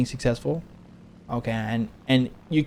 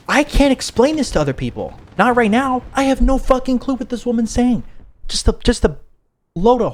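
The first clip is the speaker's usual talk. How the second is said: high, raised-voice bafflement; exasperated outburst; 11 semitones higher, 4 dB louder than usual. Real, not acted. Storyteller unable to explain her story, high loud exasperation. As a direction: let frustration push the voice up loud.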